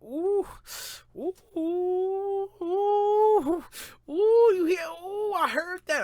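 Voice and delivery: very high, loud falsetto oohs; hammed-up hype in a long, sky-high hoot; fake hype. Rapper faking approval of his counselor's son's beats, fake hype. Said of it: "But I was acting like".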